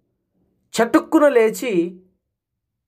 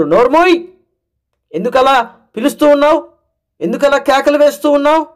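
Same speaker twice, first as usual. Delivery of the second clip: higher, scolding bystanders; telling off; 7 semitones higher than usual. Acted. Bystanders scold the blind man calling Jesus, sharp telling off. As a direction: snap at him to shut up. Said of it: "అని మాట్లాడుతున్నారు".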